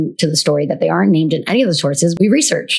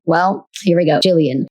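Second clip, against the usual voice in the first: ordinary delivery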